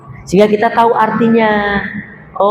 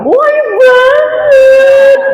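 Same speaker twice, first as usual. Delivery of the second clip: much higher, louder, slow and stretched gush of praise; hammed-up gushing husband; 5 dB louder, much slower than usual. Husband to wife praising her looks, hammed-up gushing. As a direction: overdo the flattery, crying it out. Husband's exaggerated flattering answer.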